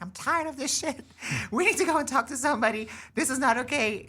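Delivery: high-pitched, exasperated mock yelling; mom's screaming rant. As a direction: imitate a fed-up mother screaming. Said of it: "she just started screaming".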